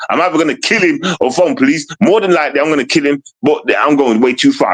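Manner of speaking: normal talking voice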